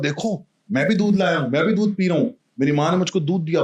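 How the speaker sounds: high, bright boast to his friends; proud happy child. Acted. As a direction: show off the milk with childish joy. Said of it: "बड़ा खुश". (दोस्तों को खुशी से, ऊँची आवाज़ में दिखाते हुए)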